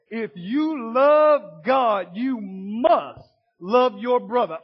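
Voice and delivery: slow, loud, hammered quote; forceful shout. Acted. Preacher shouts John's command slowly at the congregation. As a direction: drive the command home to the congregation.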